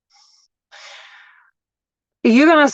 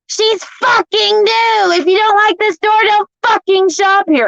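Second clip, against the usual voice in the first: imitating manager's scream; 11 semitones higher than usual. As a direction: belt it out like an enraged store manager. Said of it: "the manager screams at me".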